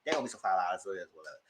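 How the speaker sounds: low unbothered mumble; dismissive whatever. Retold or imitated. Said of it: "I said".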